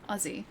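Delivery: "Aussie" softly, buzzing Z in the middle. Shown as a demonstration. Streamer repeats the right "Aussie", voiced Z sound. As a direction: say "Aussie" with the double S as Z. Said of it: "It's always with a Z".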